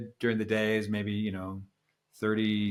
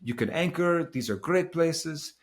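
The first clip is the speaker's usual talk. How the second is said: higher-pitched mock Italian accent; 7 semitones higher than usual. Skipper pointing out good anchorages, imitated Italian accent. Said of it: "stop with the Italian accent".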